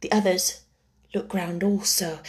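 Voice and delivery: ordinary delivery, nothing marked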